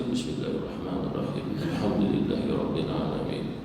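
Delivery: Bismillahirrahmanirrahim glided through with no doubled letters pressed; understated wrong reading of "Alhamdulillahi Rabbil Alamin"; single, light consonants all through Bismillahirrahmanirrahim; shaddah dropped. Shown as a demonstration. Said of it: "Jangan dibaca".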